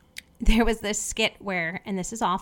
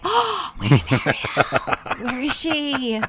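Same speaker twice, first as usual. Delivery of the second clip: seahorse voice; 5 semitones higher, much slower than usual. Retold or imitated. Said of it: "and it's like".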